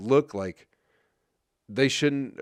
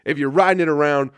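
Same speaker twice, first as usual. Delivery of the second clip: suddenly louder and higher rant; 5 semitones higher, 9 dB louder than usual. Real, not acted. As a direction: push it out like a fed-up rant. Ranting about cyclists.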